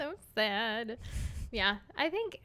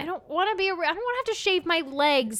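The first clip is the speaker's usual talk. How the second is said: higher, louder mock whine; whiny complaint; 7 semitones higher, 6 dB louder than usual. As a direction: whine it like a grumpy kid. Mock whining about going out.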